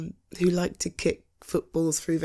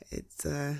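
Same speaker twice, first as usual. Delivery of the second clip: ordinary delivery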